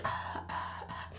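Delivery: soft, breathy "ah, ah, ah"; mock struggling gasps. Vlogger imitating a lash that won't cooperate, mock struggle. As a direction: gasp quietly as if fumbling.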